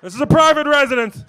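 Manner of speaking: loud, high shouted warning; high outraged shout; yelling. Acted. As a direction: bark it at the trespassers. Neighbor yelling at intruders.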